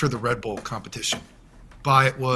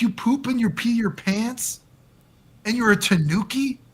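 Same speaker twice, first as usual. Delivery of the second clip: higher, slow, sneering questions; mock disbelief; 9 semitones higher than usual. To the contestant who won't follow rules, mocking high disbelief. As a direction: spell out how ridiculous it is.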